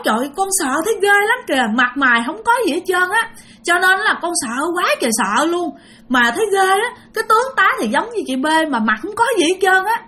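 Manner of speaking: high, hurried voice of retold fright; frightened retelling. Aunt telling the neighbours about the faceless figure, frightened. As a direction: retell the scare fast, pitch pushed up. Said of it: "cho nên dì rất là sợ".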